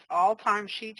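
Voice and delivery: ordinary talk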